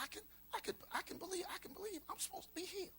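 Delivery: lightly voiced hopeful stammer; timid believer. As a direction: stammer it out like someone daring to hope. Voicing a hesitant believer.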